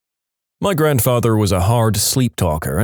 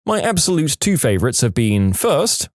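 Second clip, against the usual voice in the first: plain reading voice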